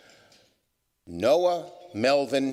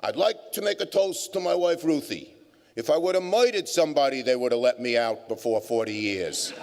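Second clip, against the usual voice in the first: put-on New York accent; 3 semitones higher than usual. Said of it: "with a twinkle in his eye".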